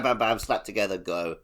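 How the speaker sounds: ordinary talk, nothing marked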